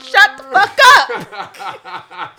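slow, loud, laughing; slow laughing yell at friends; laughing slow shout. Real, not acted. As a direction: drag it out through laughter.

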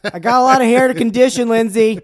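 high, loud, defensive reply; acted husband. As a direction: shoot back like a smug husband. Comic skit between a couple.

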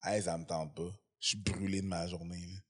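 low, quiet and worn out; tired introvert voice. Retold or imitated. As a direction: drop it down, drained after work.

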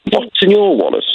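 high, slow posh British accent; a laughing posh British accent. Shown as a demonstration. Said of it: "quite a posh British accent".

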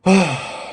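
doctor's heavy sigh; acted weary exhale; heaved sigh. A narrator's reading. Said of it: "he heaved a sigh".

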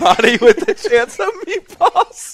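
very high, slow, squeaky mock repeat. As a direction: squeak the title back teasingly. Mocking repeat of the wrong title.

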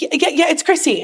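high, flustered phone answer; freaking out. Retold or imitated. She takes the producer's call, high and freaking out. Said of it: "I'm, like, freaking out".

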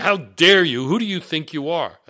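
loud, high outraged demand; imitated screaming. HR chief berating a recruit by phone, imitated screaming. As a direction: mimic an indignant boss yelling. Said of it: "screaming at me, saying".

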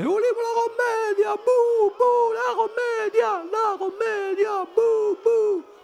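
very high drawn-out booing and chanting; high, slow crowd jeers; impatient audience yelling. A narrator's reading. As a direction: heckle like a fed-up crowd, in falsetto. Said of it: "E si a gridare".

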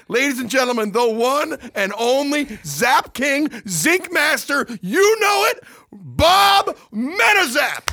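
booming, drawn-out ring announcer hype; boxing ring announcer. Acted. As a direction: bellow the intro like a prizefight announcer. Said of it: "You don't gotta announce me like that".

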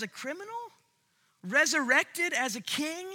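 high, wide-eyed disbelief; astonished angels. Acted. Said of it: "They're like".

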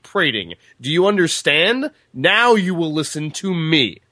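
theatrical storybook proclamation; commanding speech read aloud. A narrator's reading. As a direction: read it as a proud, formal command. Reads Roland's commanding speech from book.

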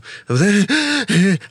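mock gasp of waking with a fright; startled waking gasp. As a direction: jolt awake gasping. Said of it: "se acorda no susto". (suspiro de susto ao acordar, encenado)

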